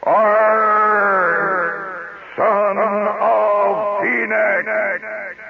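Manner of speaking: high, very drawn-out echoing cry; sing-shout the hero's title into a cave; hollered show opening. Retold or imitated. Host imitates the radio show's opening, long high holler. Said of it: "it would open up like this".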